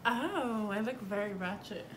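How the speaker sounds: normal talking voice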